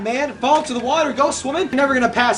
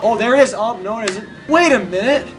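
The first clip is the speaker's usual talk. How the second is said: usual speaking voice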